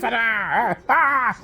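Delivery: mock a fan-boy commentator losing it; screaming fan commentator. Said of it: "He is screaming through the roof".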